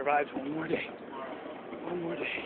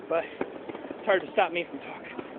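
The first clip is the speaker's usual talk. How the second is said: ordinary talk, nothing marked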